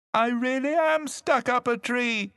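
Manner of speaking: high, loud insistent call. A narrator's reading. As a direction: call down, pleading to be believed. Cousin insists from the treetop.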